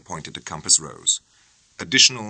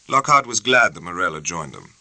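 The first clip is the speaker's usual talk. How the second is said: usual speaking voice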